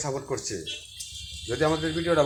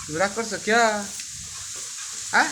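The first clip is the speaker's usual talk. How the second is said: cooing pet talk; 3 semitones higher than usual. Real, not acted. Owner asking her sulking cat why it's angry, high cooing baby talk. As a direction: coax a sulky cat in a cooing voice.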